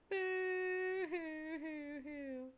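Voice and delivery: very slow, drawn-out hammed-up boo-hoo; mock sobbing. Jade Sprite sets herself off crying again, slow mock sobbing. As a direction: stretch each sob like a sulking child. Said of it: "I'm no longer gonna do Jade Sprite crying".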